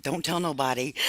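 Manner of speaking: soft, hushed mock secrecy; retold whisper. Telling her friend to keep it quiet, hushed. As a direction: hush it like a guilty secret. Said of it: "I'm, I'm whispering like".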